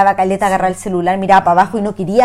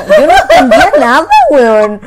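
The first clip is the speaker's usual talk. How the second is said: high, loud laughing outburst; real laughter breaking into the words; laughing disbelief; 7 semitones higher, 10 dB louder than usual. Real, not acted. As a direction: shout it helplessly through laughter. Cracking up while retelling shock.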